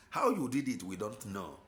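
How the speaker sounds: normal talking voice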